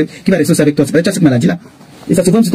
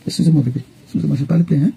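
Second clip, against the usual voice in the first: lower, softer, gently apologising to her little one; 4 semitones lower, 5 dB quieter than usual. Real, not acted.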